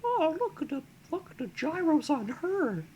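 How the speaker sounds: put-on very high-pitched childlike voice; high little-kid wonder, played for comedy; innocent child voice. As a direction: put on a tiny, innocent kid's voice. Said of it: "like, an innocent child voice".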